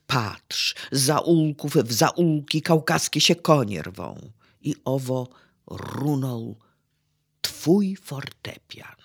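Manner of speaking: dramatyczna deklamacja, koniec szeptem; mów mocno, a „twój fortepian” wyszeptaj; recytacja wiersza. Acted. Recites dramatic fragment. (wykładowca deklamuje Chopinowi scenę Warszawy)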